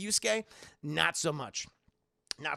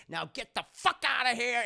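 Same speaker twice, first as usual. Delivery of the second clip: higher, louder snap to get out; mock angry parent; 6 semitones higher, 5 dB louder than usual. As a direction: throw him out in a hammed-up rage. Parents throwing him out.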